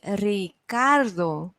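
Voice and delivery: slow "Ricardo", split as Ri-CAR-do; stress on CAR. Shown as a demonstration. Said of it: "¿Cuál es la sílaba tónica?".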